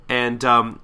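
ordinary delivery

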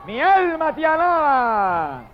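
very high, drawn-out hallelujah cry; shouted praise. Retold or imitated. Preacher voices the multitude of heaven crying again, high shout. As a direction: cry out praise at full stretch. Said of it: "la multitud del cielo vuelve y grita".

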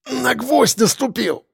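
high, slow, strained voice; pained exhalation. A narrator's reading. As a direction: force it out through the pain. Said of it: "выдохнул Лисицын". (на выдохе, сквозь боль)

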